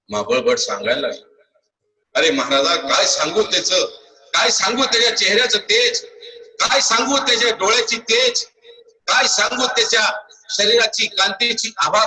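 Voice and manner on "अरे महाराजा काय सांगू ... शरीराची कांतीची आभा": rising, high-pitched rapture; marvelling. Acted. Priest describes the child's glow to the king, marvelling. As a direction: build up in wonder with each phrase.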